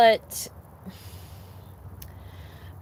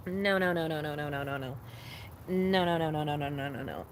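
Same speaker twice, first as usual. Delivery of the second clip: each string of no's drops softer and lower; fading no's; 5 semitones lower, 7 dB quieter than usual. Real, not acted. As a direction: trail the no's off to nothing. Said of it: "repeated no fading".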